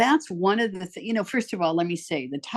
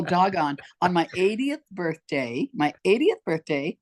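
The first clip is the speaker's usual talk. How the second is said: usual speaking voice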